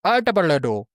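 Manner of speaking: high goofy robot babble; silly made-up Chappie noise. Pretend. Chappie babble for a friend, high and goofy. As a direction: make a nonsense noise as the robot. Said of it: "so I just made a noise".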